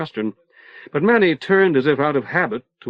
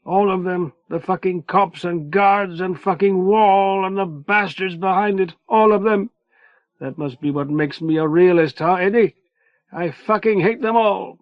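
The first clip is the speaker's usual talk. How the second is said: angry; 7 semitones higher than usual. A narrator's reading. Manny out of breath, raging.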